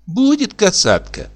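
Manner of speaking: put-on high childish answer; little girl voice. A narrator's reading. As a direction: answer sweetly like a small girl.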